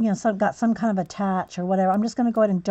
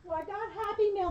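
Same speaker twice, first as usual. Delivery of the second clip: usual speaking voice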